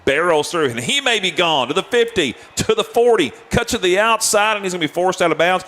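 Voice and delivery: rising, fast excited call; excited play-by-play. Real, not acted. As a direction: call the run as it breaks open. Live run call.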